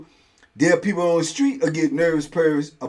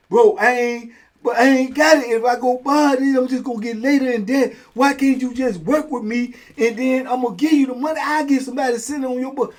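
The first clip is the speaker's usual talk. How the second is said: begging debtor; 7 semitones higher than usual. Retold or imitated. Debtor begging for more time.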